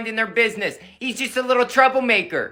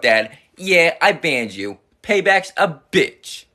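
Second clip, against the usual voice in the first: normal talking voice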